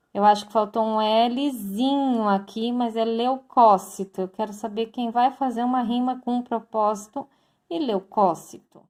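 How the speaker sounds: ordinary talk, nothing marked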